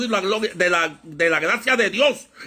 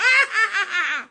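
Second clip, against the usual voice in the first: high-pitched cackle of an amused crowd; high mimicked laughter; 13 semitones higher than usual. Preacher mimics everyone laughing at him, high mock laughter. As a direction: imitate everybody bursting out laughing.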